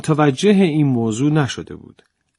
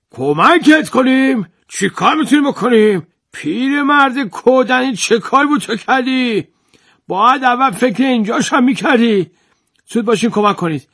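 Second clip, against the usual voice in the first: higher, flustered bossy landowner; fussy landowner flustered; 9 semitones higher than usual. Acted. Landowner flusters over the stuck jar, high and bossy. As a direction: fuss and bark orders while helping.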